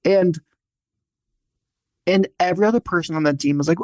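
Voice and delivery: ordinary talk, nothing marked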